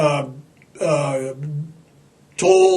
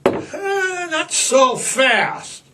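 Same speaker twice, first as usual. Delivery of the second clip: slow, drawn-out doubting; lazy mock caution; skeptical; 8 semitones higher, much slower than usual. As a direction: hold them off slowly, unconvinced. Sceptical retort.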